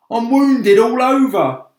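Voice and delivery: whiny loud centipede; loud high complaining bug voice. Acted. Centipede whining to the others, loud high complaint. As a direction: wail about your wounds.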